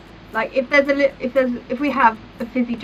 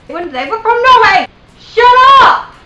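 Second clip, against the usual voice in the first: screamed, much higher and louder; exploding in anger; 10 semitones higher, 12 dB louder than usual. Acted. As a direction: lose it completely at the stubborn mum. Argument with mum boils over.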